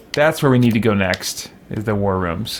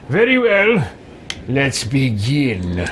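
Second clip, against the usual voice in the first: role-played judge; slower than usual. Player as judge opening the court, slow and hammed-up.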